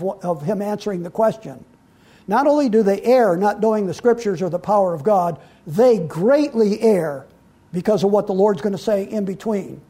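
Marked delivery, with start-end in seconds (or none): 5.7-7.3 "greatly err" drawn out slowly for emphasis; emphatic stress. Real, not acted. Preacher driving home the verse's point, stressing "greatly err" slowly.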